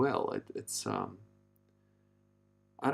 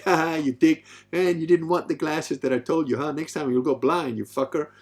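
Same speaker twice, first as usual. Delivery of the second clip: louder, higher mocking laugh-taunt; jeering banter; 6 semitones higher, 7 dB louder than usual. Man ribbing a friend scratched by a chainsaw, jeering banter. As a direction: rib your mate loudly. Teasing a male friend after accident.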